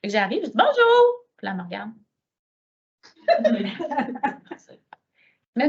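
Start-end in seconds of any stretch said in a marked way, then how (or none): 0.6-1.3 much higher, louder cheery hello; over-friendly high hello; bright greeting. Retold or imitated.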